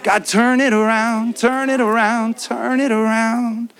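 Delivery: slow, pleading prayer to God; earnest prayer. Retold or imitated. Preacher praying aloud to God, slow and pleading. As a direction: plead with God slowly, each "turn it around" heavier. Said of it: "I'm praying".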